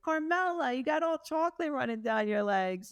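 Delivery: lightly voiced mom teasing Carmela; playful mom teasing. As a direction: tease your little girl like a game. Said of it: "And I was like".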